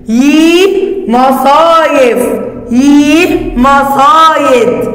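put-on each word stretched very slowly for children; teacher reading words slowly for kids; slow sounding out. Shown as a demonstration. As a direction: drag every syllable so kids can follow. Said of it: "تعال نشوف الكلمات".

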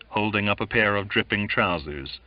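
plain reading voice

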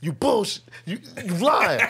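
lightly voiced, high-pitched disbelieving sergeant; mocking sergeant. Sergeant calling him a liar about being sick. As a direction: call his bluff with a half laugh.

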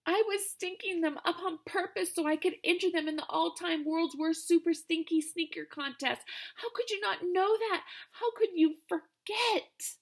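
high, distraught complaint; plead, still upset and high; whiny upset. Acted. Stink upset at sister.

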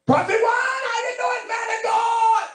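high, loud public hail across the room; booming overeager greeting in public; hollered greeting. Retold or imitated. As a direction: call out the title loudly so everyone hears. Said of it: "he hollered".

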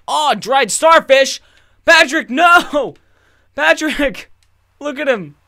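high, loud, slow squeal of alarm; high cartoon voice crying out in panic. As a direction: shriek for the starfish in cartoon horror. Cartoon characters in distress.

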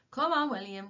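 sharp call. A narrator's reading. Tom to the puzzled, staring Willie, sharp call. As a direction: call him along sharply. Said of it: "called Tom sharply".

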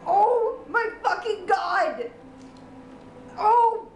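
high, slow disbelieving cry of shock; stunned outburst. Real, not acted. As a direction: let it drag out in disbelief. Shock at the game's ending.

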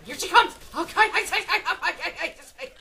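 high, loud, stammering alarm; panicked yelling. Real, not acted. Gamer as an enemy rushes in, panicked stammering yell. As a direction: yell and trip over your words in a panic.

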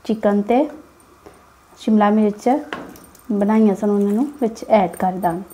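usual speaking voice

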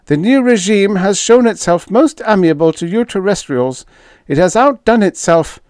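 high, loud insistent alien voice; protesting alien official. A narrator's reading. Fifth defending the new regime to terrestrials, protesting. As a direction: plead the case high in pitch.

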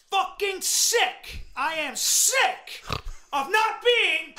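slow, emphatic outburst; fed-up, heavily punched words; worked-up rant. Real, not acted. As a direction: spit out each phrase with exasperation. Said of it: "Hey, calm down".

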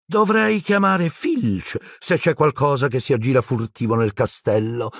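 high-pitched needling sprite; threatening poltergeist. A narrator's reading. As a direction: threaten gleefully high, slowing at the end. Peeves threatens to call Filch. (spiritello maligno, minaccia in voce acuta)

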